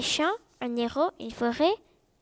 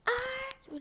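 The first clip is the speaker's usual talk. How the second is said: chuchoté; 10 semitones higher, 7 dB quieter than usual. A narrator's reading. Chuchotement de compte à rebours.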